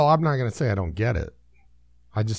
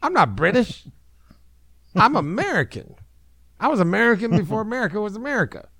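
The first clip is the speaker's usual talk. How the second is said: higher, strange prehistoric beast voice; ancient mastodon voice; 9 semitones higher than usual. Acted. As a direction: play an indignant ancient mastodon. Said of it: "that's the Mastodon".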